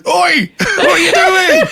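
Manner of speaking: high, drawn-out mock "oi"; imitated indignant call-out. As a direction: imitate someone calling out in protest, laughing. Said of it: "I just heard his voice going".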